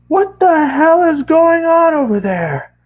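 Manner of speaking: hammed-up upset dad calling his son; angry dad voice. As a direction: play the furious father on the phone.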